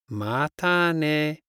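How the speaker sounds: slow. Shown as a demonstration. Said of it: "See you later".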